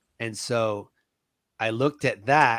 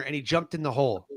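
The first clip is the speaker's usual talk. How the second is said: ordinary talk, nothing marked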